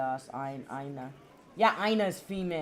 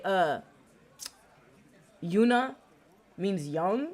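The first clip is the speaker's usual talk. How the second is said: usual speaking voice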